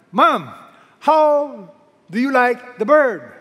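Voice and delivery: high, loud, slow eager son on the phone; son calling mom. Retold or imitated. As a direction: call out to mom, hoping she loved the gift. Son calls mother in joke.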